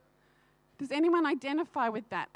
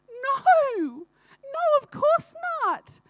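much higher and louder, gently reassuring pastor; kind pastor's voice; 13 semitones higher, 7 dB louder, much slower than usual. Retold or imitated. Pastor reassuring her at the door, bright and high. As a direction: play the pastor waving away her fear, loud and high.

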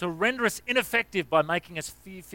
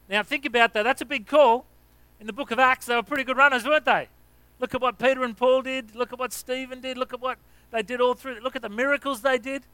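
higher, louder rousing preaching; preaching at full force; 7 semitones higher, 4 dB louder than usual. Acted. Pastor urging the church to act like Acts, preaching at full force. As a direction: drive it home with fire.

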